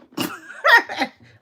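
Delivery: real high laughing burst; loud laugh. Real, not acted. Laughs at her own line.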